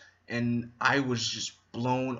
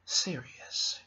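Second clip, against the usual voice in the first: breathy whisper on serious; 8 dB quieter than usual. Shown as a demonstration. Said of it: "it just sounded so".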